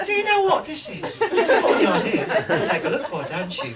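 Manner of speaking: high, loud put-on English accent. Writer in disguise shows his script around the agency, English accent. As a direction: show it off in a fake English accent. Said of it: "I'm speaking in an English accent".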